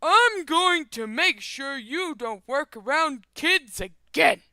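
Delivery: slow, high, loud angry father; hammed-up shouting dad. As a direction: raise your voice into an acted threat. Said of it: "father, getting louder".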